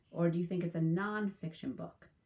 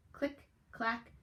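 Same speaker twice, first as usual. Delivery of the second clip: lively storybook read-aloud; animated picture-book narration; reading to children; 3 dB louder than usual. Acted. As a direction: tell it to a class of young kids.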